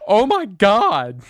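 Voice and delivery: high, loud, drawn-out mock amazement; hammed-up escalating disbelief; imitating astonished wife. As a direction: stretch it out as an overwhelmed wife. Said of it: "just kept going".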